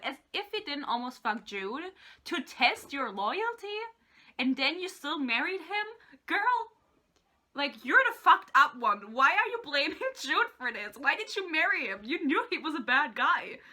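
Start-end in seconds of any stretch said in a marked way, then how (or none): 6.3-11.0 exasperated callout. Real, not acted. Ranting at character.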